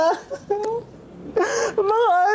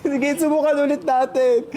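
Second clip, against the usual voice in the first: ordinary talk, nothing marked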